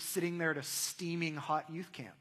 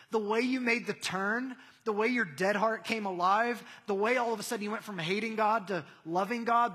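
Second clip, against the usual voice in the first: raised, driving sermon voice; higher, emphatic pulpit build; impassioned preaching; 5 semitones higher, 6 dB louder than usual. Real, not acted. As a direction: preach it with force, hammering each repeated phrase. Preaches at full force.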